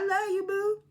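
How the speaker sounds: high, drawn-out put-on affection; mock sweet-talk. Heavenly giving her a hug, high mock-affectionate cooing. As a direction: coo it high and long, hamming up the love.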